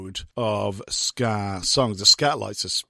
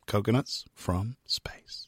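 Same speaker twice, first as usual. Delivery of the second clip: hushed whisper of the title; 5 semitones lower, 9 dB quieter than usual. Acted. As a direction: breathe the title as a secret.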